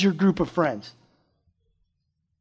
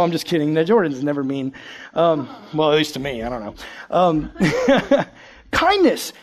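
ordinary talk, nothing marked

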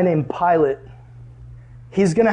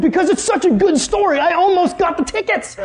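proud friend; 11 semitones higher than usual. Retold or imitated.

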